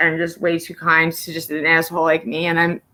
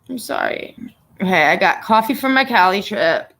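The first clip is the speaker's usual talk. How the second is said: ordinary talk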